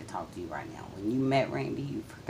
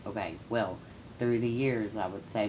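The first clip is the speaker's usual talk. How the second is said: ordinary talk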